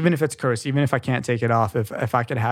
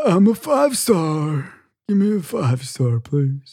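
a slow, lightly voiced old man; lightly voiced grandpa asking; 3 semitones higher, slower than usual. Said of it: "[name]'s old man voice".

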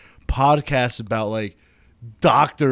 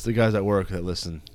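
ordinary delivery

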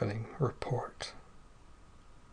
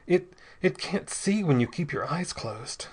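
higher, halting urgent plea; gasping; 5 semitones higher than usual. A narrator's reading. Man struggling for the gun explains to his friend, gasping. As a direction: stammer it out mid-struggle. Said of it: "gasped Bell".

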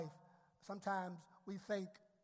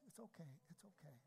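quiet, quick repeated reassurance; wife calming her husband in the car, soft and hurried; soothing wife; 4 semitones lower, 25 dB quieter, faster than usual. Retold or imitated. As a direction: hush him gently so he calms down. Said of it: "she's like".

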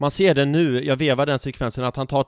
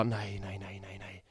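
low, fading, hushed mutter; defeated no-no-no, dying away; retold despair; 6 semitones lower, 17 dB quieter than usual. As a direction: sink into near-silent disbelief. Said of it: "sjönk jag ihop på knä framför T V:n och bara".

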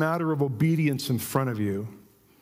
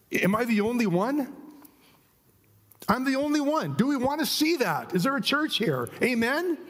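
rousing call; 9 semitones higher than usual. Acted. Preacher rousing congregation.

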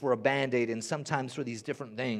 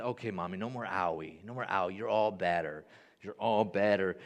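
soothing daddy; 7 semitones lower than usual. Retold or imitated. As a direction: hush a little one gently, much lower. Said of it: "and I'll say".

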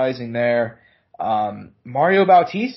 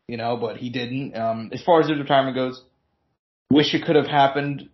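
ordinary talk

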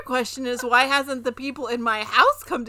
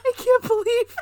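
hammed-up wounded wail, half laughing; scolded in her own home, mock-crying; mock betrayal; 7 semitones higher than usual. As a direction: play the betrayed victim, higher. Mock betrayal after being scolded.

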